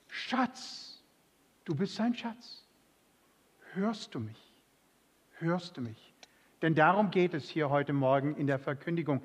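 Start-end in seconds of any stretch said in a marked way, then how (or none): 0.1-6.1 leise, gehauchte Stimme Gottes; zärtlich leise. Pretend. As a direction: sanft und liebevoll wie zu einem Geliebten. Gott spricht zärtlich zur Gemeinde. (leise zärtlich, fast geflüstert)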